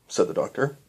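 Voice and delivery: ordinary delivery, nothing marked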